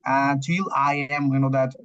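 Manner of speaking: usual speaking voice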